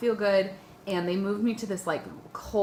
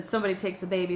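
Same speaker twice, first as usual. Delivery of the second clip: ordinary talk, nothing marked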